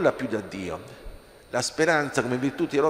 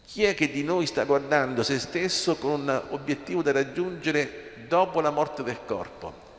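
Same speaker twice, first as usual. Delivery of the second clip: usual speaking voice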